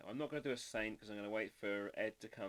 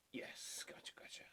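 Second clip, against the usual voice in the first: whisper; 8 dB quieter than usual. Real, not acted. Acknowledging chat.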